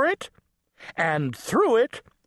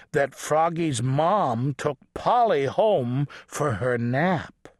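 plain reading voice

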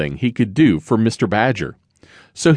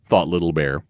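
usual speaking voice

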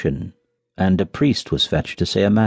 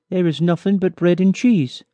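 higher-pitched miller's wife; 9 semitones higher than usual. A narrator's reading. Miller's wife answering about supper, high-pitched voice. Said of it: "But the wife said".

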